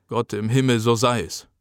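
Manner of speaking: usual speaking voice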